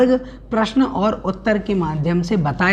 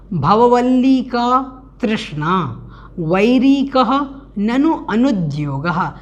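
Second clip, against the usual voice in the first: measured teaching recitation; slow recitation; slower than usual. Shown as a demonstration. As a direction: recite the prose order slowly, phrase by phrase. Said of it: "इस प्रकार से इसका अन्वय हुआ".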